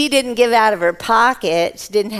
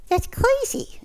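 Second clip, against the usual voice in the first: high exclaiming; 13 semitones higher, 3 dB quieter than usual. Acted. Voiced disciple reacts in story.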